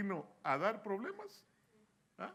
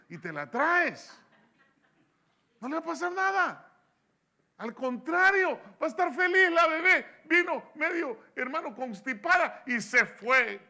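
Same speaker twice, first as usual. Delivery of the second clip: much louder and higher, shouting the promise; full-force preaching; 9 semitones higher, 11 dB louder than usual. Acted. Pastor assuring parents the baby will be fine, shouted preaching. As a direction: preach it at the top of your voice.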